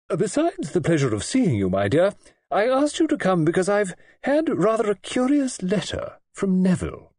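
put-on high, crisp old-lady voice; a grand old lady getting to the point; imperious old lady. A narrator's reading. Old lady raising Neville's letter with her guest.

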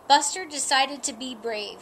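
ordinary delivery

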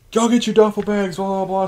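high, put-on barking sergeant; drill sergeant voice. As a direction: order them around like a drill sergeant. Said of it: "the first sergeant's like".

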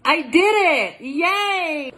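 high, drawn-out gleeful yay; triumphant cheer. Real, not acted. Celebrating a kitchen win, triumphant cheer. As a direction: cheer your own small victory.

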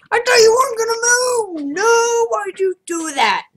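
high, loud anguished protest; distraught yell. Real, not acted. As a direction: cry out betrayed. Game son moves, dies.

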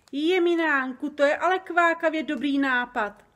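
loud, high-pitched delight; excited exclamation. A narrator's reading. The giant hails Sofie's seating idea, exclaiming loudly. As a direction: cry out at a wonderful idea. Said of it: "vykřikl Obrdobr".

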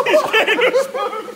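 cracking up as she grabs the spoon; laughing loudly. Real, not acted. Friend fetches spoon, cracking up.

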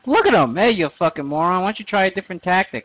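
high, loud jeering at the enemy; mocking taunt. Real, not acted. Gamer taunting a dumb enemy, high and loud. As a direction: jeer at him up high and loud. Yelling mockery at game enemy.

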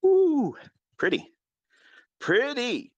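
high, loud cooing ooh, then pretty; mock admiring coo. Teller cooing over the orange Lamborghini, mock admiring. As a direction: gush over the car, half mocking.

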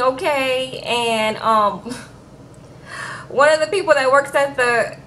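usual speaking voice

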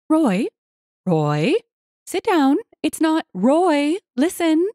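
high, urgent pleading wife; trying to get attention. A narrator's reading. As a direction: break in to calm your husband down. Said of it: "she tried".

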